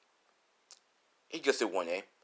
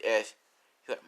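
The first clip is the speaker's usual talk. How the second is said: plain reading voice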